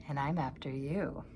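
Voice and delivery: hint of a quiet threatening cat; sly cat. A narrator's reading. Cat answering the bird, low and quiet.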